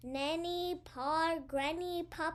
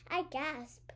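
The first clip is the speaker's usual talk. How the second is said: plain reading voice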